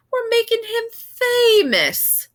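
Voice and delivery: high, loud, slow smug boast; smirking. A narrator's reading. Ex-dad boasting to the anchorman, slow smug smirk. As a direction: savour it like a showman. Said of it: "Ex-dad smirked".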